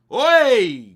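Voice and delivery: a loud, sudden shout of oi. Real, not acted. Fan watching the match shouts oi at Arsenal's attack. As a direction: bark oi at the screen as Arsenal break.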